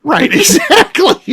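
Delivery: high laughing agreement. Real, not acted.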